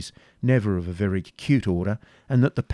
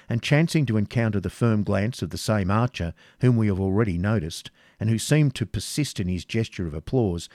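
ordinary delivery, nothing marked

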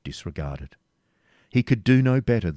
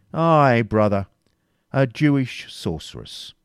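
loud, higher, slow insistence; stern; 5 semitones higher, 6 dB louder, slower than usual. A narrator's reading. Grand Master confirming the charge, stern. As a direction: lean hard on every word. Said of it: "said the Grand Master sternly".

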